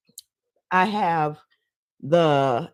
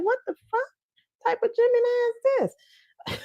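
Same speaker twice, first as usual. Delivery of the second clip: much higher, stunned disbelief; incredulous disbelief. Retold or imitated. Watching a TV guest answer questions, in high incredulous disbelief. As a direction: squeak it out like you can't believe her answer. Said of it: "I was like".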